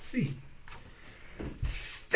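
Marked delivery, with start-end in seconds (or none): 1.6-2.2 stop. A narrator's reading. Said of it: "said the ram".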